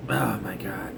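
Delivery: breathy whispered groan under the breath; whispered oh my God; exasperated whisper. Real, not acted. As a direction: mutter it in despair, barely voiced. Exasperated aside about Joe Hendry.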